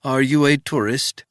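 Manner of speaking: put-on wary old housekeeper in a thick Swiss accent; old housekeeper questioning a stranger, thick Swiss accent. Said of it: "in a thick Swiss accent".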